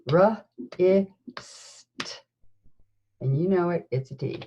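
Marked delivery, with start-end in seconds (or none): 0.1-2.2 R, I, st, each sound split apart; sounding out words. Shown as a demonstration. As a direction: segment wrist into R, I, st with pauses. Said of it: "And the last sound".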